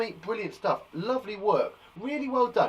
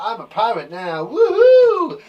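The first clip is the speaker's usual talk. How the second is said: loud, drawn-out cheers; gleeful cheering; 10 dB louder, much slower than usual. Pretend. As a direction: whoop it up with long vowels. Celebrates loudly before the class.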